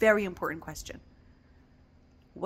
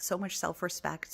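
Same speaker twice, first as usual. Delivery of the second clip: usual speaking voice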